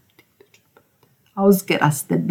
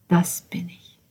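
whisper; 7 dB quieter, slower than usual. Acted. Repeated in whisper.

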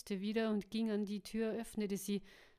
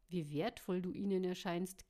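usual speaking voice

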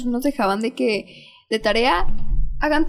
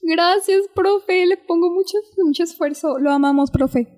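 high, louder suck-up voice; sweet-talking the teacher; 7 semitones higher, 3 dB louder than usual. Retold or imitated. Student mimicking herself thanking the teacher, high and syrupy. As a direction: lay on the flattery, sugary high. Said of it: "Y yo".

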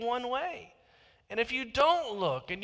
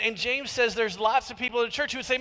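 usual speaking voice

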